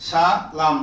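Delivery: সালাম split into two parts, সা and লাম; teacher breaking up সালাম for learners; syllable by syllable. Shown as a demonstration. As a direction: sound out সালাম slowly in separate syllables. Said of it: "উচ্চারণ শুধু, বানান নাই আর".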